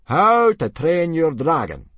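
loud, slow, high proclamation; big ceremonial title reading; solemn announcement. A narrator's reading. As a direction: announce the title grandly, word by word. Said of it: "announced Gobber solemnly".

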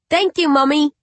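put-on high, innocent little child asking; a small uncanny baby calling its mother. Undead baby asks its grieving mother, high and childlike.

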